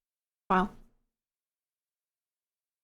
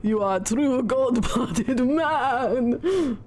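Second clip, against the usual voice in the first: put-on Russian accent; slower than usual. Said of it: "I'm in character now".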